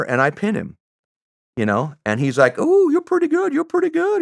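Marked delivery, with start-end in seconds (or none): 2.6-4.2 very high mock Southern voice; put-on Southern accent in high register. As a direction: coo it as an impressed Southern gent, way up high. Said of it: "I don't have a very good Southern accent".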